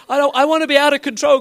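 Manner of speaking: high, loud eager cry; giddy, high-pitched prayer; exuberant plea. Acted. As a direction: call it out to God with abandon. Acted exuberant prayer to God.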